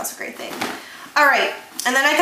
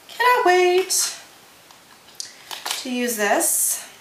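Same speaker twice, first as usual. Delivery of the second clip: excited squeal; 9 semitones higher, slower than usual. Real, not acted. Gushing over new lip gloss.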